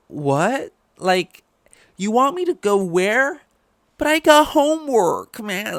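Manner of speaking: put-on very high, loud, whining teenager; whiny teen voice. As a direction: whine like a put-upon kid. Voicing whiny teen hero.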